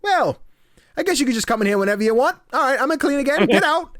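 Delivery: exasperated British homeowner. Retold or imitated. Gamer voicing the homeowner fed up, high fast mock British. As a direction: rush it like a fed-up bloke.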